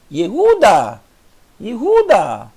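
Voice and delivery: "Yehuda" with the Ye swallowed fast; quick Ye sound. Shown as a demonstration. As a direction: model "Yehuda", barely sounding the e. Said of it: "Veja que é tão rápido o I". (professora lendo "Yehuda" com o Ye quase engolido)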